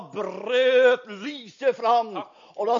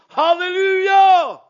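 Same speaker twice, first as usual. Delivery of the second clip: hallelujah shouted out, much higher and louder; 9 semitones higher, 12 dB louder, slower than usual. Shown as a demonstration. Preacher crying out his praise to the congregation, loud shout. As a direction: throw the praise up to the rafters. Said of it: "Derfor kan jeg si".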